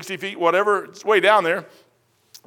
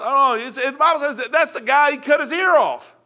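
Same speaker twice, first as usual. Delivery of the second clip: ordinary talk, nothing marked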